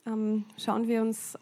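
ordinary talk

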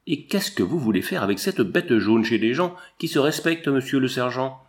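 ordinary delivery

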